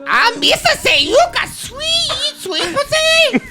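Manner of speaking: squeaky high Jar Jar Binks voice, slow and silly; high, drawn-out alien clown voice. Retold or imitated. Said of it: "do it one more time as Jar Jar".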